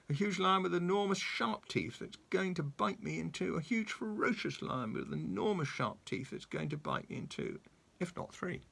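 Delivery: high, breathless fearful babble; hammed-up panic. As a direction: spiral into comic terror about the lion. Mister Jelly imagines a lion.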